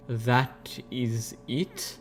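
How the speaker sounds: very slow, soft, hesitant question; cautious. A narrator's reading. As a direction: ask warily, softly and haltingly. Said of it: "she asked cautiously".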